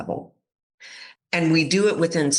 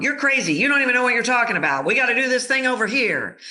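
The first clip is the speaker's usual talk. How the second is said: much higher, fast scoffing voice; dismissive board; 8 semitones higher, much faster than usual. Retold or imitated. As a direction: brush him off like he's crazy. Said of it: "they're going".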